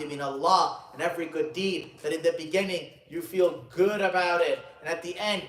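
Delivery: high, loud, emphatic sermon delivery; preaching at full force. Acted. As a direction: preach it out, leaning on key words.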